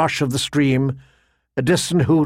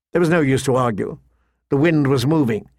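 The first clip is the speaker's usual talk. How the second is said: ordinary delivery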